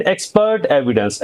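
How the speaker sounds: ordinary talk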